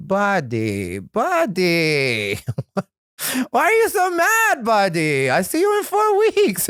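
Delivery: put-on high, accented voice of the patient booker; mock a gentle foreign-accented boss calling him buddy; Jamie's accent. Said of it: "'cause Jamie has that accent".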